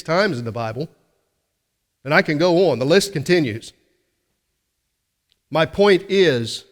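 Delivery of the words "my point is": drawn out slowly and a little louder; slow right down to land the point; preacher pausing to drive it home; slowed emphasis. Acted. Preacher slows for the key point.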